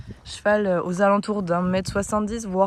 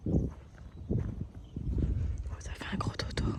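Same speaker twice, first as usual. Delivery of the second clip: whispering; 11 dB quieter than usual. Real, not acted. Near a sleeping foal.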